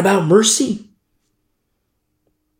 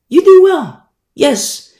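emphatic declaration; 12 semitones higher, 7 dB louder, slower than usual. Real, not acted. Preacher answers, loud and high.

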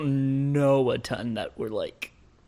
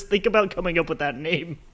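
usual speaking voice

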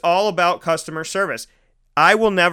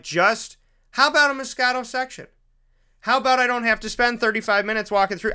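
higher, pleading and fed up; exasperated plea; 7 semitones higher than usual. Retold or imitated. Customer pleading with the wine clerk, exasperated and higher. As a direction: beg for a simple answer, pitched up.

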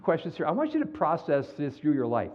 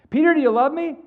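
higher, louder, more insistent; Jesus asking again; 12 semitones higher, 9 dB louder than usual. Retold or imitated. Jesus repeats to Peter, higher and louder. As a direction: push harder this time.

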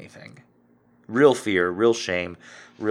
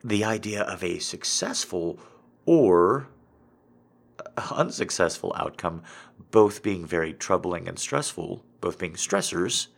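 ordinary talk, nothing marked

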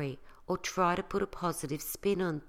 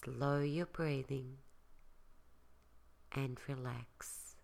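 soft spa whisper; 2 semitones lower, 7 dB quieter than usual. Acted. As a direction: talk them gently into calm, softer. Leads a guided relaxation.

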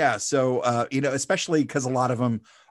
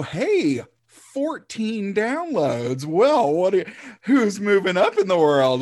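higher, drawn-out mock amazement; mock impressed; 7 semitones higher, slower than usual. Podcaster marveling at his own downloads, mock impressed. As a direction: puff up a tiny success as big news.

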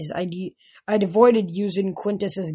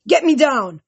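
loud, higher angry demand; 5 semitones higher, 5 dB louder than usual. A narrator's reading. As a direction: order it, fuming and stuck. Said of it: "Antaeus demanded".

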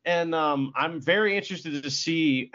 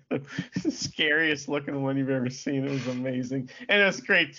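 ordinary talk

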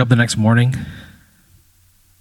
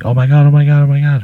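high, loud squeals of joy; girl sees her stolen bike returned, shrieking with joy; excited screaming; 7 semitones higher, 7 dB louder, faster than usual. Acted. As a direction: scream it with delight. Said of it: "She just starts screaming".

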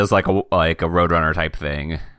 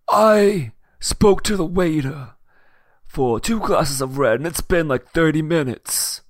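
impatient gangster voice; 8 semitones higher than usual. Acted. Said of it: "He says".

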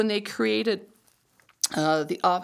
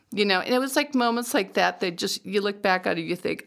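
normal talking voice

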